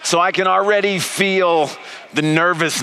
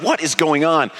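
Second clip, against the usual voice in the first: ordinary talk, nothing marked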